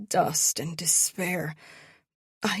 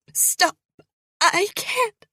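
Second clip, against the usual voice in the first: much higher, loud choking cry; cracking scream; 14 semitones higher, 7 dB louder than usual. Acted. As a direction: scream while being strangled. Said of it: "My voice cracks".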